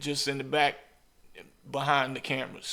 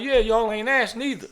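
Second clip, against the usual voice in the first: higher, louder mock-sassy comeback; imitating her teasing retort, pitched up; 8 semitones higher, 5 dB louder than usual. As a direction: toss it back at them with sass. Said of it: "She was like".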